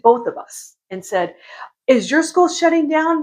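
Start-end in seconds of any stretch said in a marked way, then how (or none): 1.9-3.2 mock concern. Sister's text quoted.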